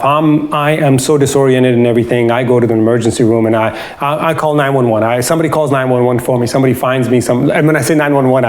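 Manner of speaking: ordinary talk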